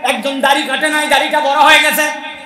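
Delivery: high, loud sermon shout; fiery preaching. Acted. Preacher shouting his point at the crowd. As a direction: preach it to the back row.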